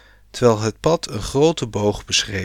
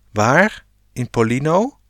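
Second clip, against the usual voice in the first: surprised; 3 semitones higher than usual. A narrator's reading. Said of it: "vroeg Eefje verrast".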